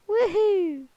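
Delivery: high, loud whoop of joy; woohoo cheer. Real, not acted. Gamer flying high, whooping loud.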